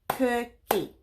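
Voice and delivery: cookie said in two beats, COO-KIE; clapped syllables. Shown as a demonstration. Teacher to kids, clapping out the syllables. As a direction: split cookie into its two claps. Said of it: "Say the word cookie with me and let's clap it".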